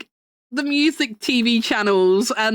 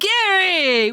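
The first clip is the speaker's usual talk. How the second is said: mock angry shout; 8 semitones higher than usual. Mock scolding streamer friend.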